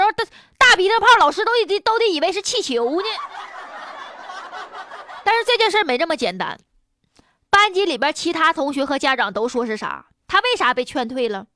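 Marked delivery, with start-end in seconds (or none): none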